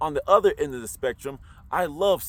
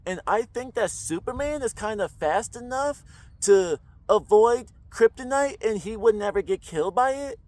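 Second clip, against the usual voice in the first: every line lifted higher like a question; Valley Girl voice, every sentence ending as a question; Valley Girl uptalk; 4 semitones higher than usual. Shown as a demonstration. Mocking Valley Girls for viewers, uptalk higher throughout. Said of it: "They all tend to talk like this".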